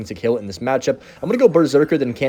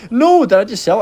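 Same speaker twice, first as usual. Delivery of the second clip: alarmed; 9 semitones higher, 5 dB louder than usual. Real, not acted. Thinks he threw the game.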